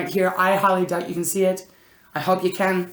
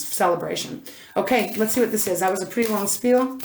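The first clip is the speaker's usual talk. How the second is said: usual speaking voice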